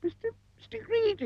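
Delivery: tiny high-pitched stammering squeak; barely manage to squeak it out; frightened squeak. A narrator's reading. Frightened captive tells the giant his name, squeaking.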